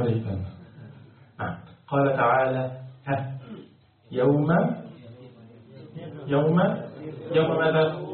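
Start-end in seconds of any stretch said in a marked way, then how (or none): none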